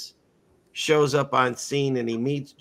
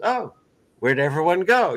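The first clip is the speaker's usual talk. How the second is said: higher, mock-innocent wondering where everyone went; nonchalant; 4 semitones higher, 4 dB louder than usual. Jesus looking up at the empty crowd, higher, mock-innocent nonchalance. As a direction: act casually surprised, as if nothing happened. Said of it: "nonchalantly looks up and goes".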